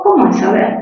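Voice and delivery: ordinary talk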